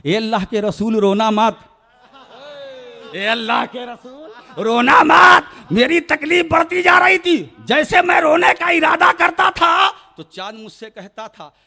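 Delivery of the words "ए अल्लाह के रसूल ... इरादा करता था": loud pleading cry, rising higher; fervent sermon shout; impassioned preaching. Acted. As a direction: plead with the crowd at full voice. Moon's plea, then rising sermon.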